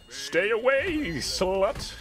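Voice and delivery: high, loud, drawn-out warning; slow, high-pitched shriek at an opponent; mock-panicked yell. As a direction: stretch out a squealed order to back off. Warding off opponent in game.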